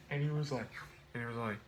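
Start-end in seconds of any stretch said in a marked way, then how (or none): none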